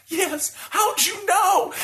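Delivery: high, slow, overjoyed woman's voice; thrilled high squeal. Retold or imitated. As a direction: gush it out like she's been flattered. Birthday woman near tears.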